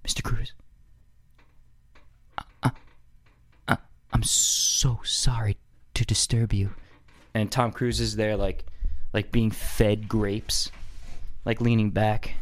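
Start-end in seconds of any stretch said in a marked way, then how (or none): 0.0-6.7 hushed, timid aide whispering; trembling whispered apology; nervous whisper. Retold or imitated. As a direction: whisper it, scared to disturb the boss. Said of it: "nervous to speak to him".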